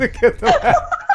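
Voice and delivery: squealing laugh, very high; high burst of laughter. Real, not acted. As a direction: crack up suddenly.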